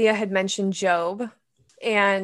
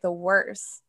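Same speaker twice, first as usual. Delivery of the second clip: usual speaking voice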